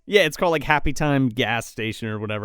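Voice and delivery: normal talking voice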